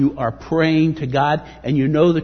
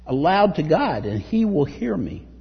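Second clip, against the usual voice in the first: raised, forceful scripture reading; preaching scripture; 7 semitones higher, 4 dB louder than usual. Acted. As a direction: proclaim the psalm loudly to the congregation. Reads psalm with force.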